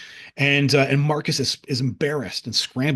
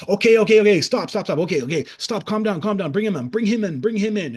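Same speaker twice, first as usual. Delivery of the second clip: higher, loud calling out; clerk calling for calm; 7 semitones higher, 4 dB louder than usual. Retold or imitated. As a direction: raise your voice to settle a crowd. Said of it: "he's like".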